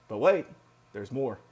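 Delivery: a sudden loud burst on "But wait", then drop back down; hammed-up TV salesman tease; mock infomercial pitch. Infomercial catchphrase mid-calculation.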